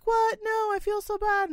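high, gushing mock apology; apologetic teacher. Teacher apologizing for forgetting her soda, high and gushing. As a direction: squeal a sorry like a flustered teacher. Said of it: "She was like".

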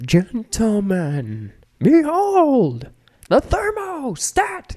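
high, slow, grand cartoon announcer; Doctor Weird voice. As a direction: unveil it with theatrical grandeur. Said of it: "Doctor Weird, at every episode, he's like".

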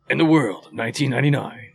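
a lightly voiced trailer read for a beer review; trailer narrator. Movie trailer beer intro.

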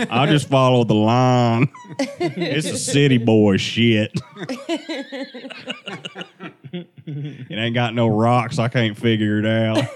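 loud, slow, laughing Southern drawl; exaggerated Southern accent. Driver answering puzzled New Zealand passengers, hammed-up Southern drawl. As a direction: ham up a backwoods Southern accent through laughter.

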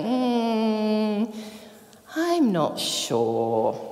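slow, hesitant doubting murmur; lightly voiced doubtful disciple, slowed right down. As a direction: drag it out like an unconvinced follower. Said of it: "I kind of imagine the disciples might be sitting there going".

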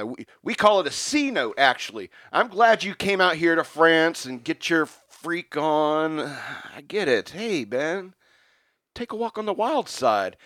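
high, loud mock rant. Still ranting at Ben Franklin before the Parisian girls sing. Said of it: "I'm still ranting at 'em".

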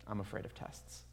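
a hushed admission of fear; whispered confession. Real, not acted. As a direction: confess it under your breath. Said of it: "if I could just be honest".

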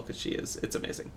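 breathy hushed whisper; whispered awe. Real, not acted. Awed aside.

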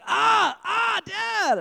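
imitated child's high shout; dad mimicking his son's high, loud cry; screaming toddler. Son yelling for dad from another room, mock high scream. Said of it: "he's like".

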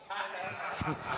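a quick soft chuckle; laugh. Real, not acted. Laughing at the timid congregation.

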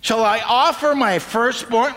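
slow, lightly voiced exasperated question; a hint of an exasperated people; exasperated Israelites. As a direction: throw it up in the air, hands up. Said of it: "throwing their hands up in the air".

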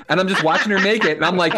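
normal talking voice

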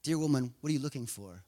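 soft, low and tender; hushed compassion; gentle question. Acted. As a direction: ask it quietly, as to someone grieving. Jesus gently questions weeping Mary.

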